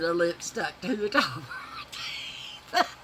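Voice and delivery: giggling under the breath; words squeezed out in a breathless laugh; whispered laugh. Real, not acted.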